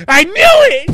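very high, loud shriek of triumph; mock outraged shout. Wife reacting to husband's bedtime confession, mock outraged shout. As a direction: imitate a wife exploding, way up high. Wife's retort in retold quarrel.

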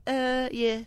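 high, drawn-out dazed reply. Retold or imitated. Pop star answering after his fall, high mock-dazed voice. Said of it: "[name], did you fall?".

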